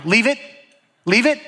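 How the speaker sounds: dog owner calling out to a digging dog; mock shout. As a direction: yell "Leave it" across the park, half in jest. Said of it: "They're just shouting".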